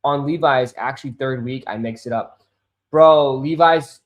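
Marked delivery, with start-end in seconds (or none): none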